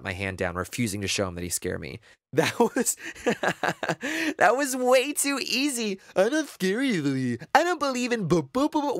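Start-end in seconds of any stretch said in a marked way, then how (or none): 2.3-6.0 high, slow words through laughter; laughing friend. Acted. Ten laughing at the prank. 6.2-9.0 high, mock stammer of a scared friend; mocking imitation. Ten mocking Staver's fear.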